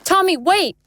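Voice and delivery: high, loud call after him. Acted. Sophie calling after Tommy as he leaves, high loud call. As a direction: shout to stop him leaving.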